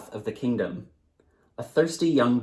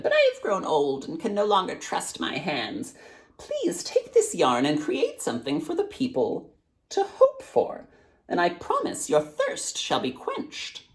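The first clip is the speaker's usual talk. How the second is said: put-on high, fading old crone; old woman's voice; 10 semitones higher than usual. A narrator's reading. Old woman gives the weaver the yarn, high then fading. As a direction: promise it like a mysterious old woman.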